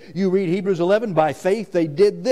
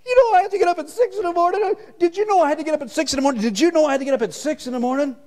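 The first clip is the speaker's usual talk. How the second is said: high, fast mock whine; mock whining complainer; 13 semitones higher, much faster than usual. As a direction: rattle it off like a spoiled complainer. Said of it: "how dumb that sounds".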